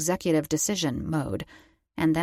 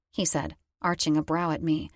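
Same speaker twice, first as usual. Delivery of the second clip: ordinary delivery, nothing marked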